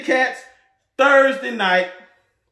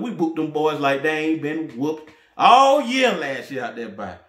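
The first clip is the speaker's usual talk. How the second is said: ordinary talk, nothing marked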